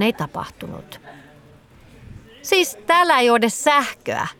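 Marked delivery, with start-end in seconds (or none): 2.4-4.3 high-pitched, mildly exasperated complaint. A narrator's reading. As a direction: protest with a touch of frustration. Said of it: "hän tuskastui".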